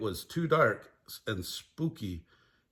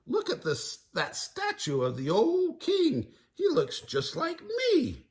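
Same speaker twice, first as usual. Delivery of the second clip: high, slow goofy Shaggy; proud of his look. Acted. Shaggy voiced.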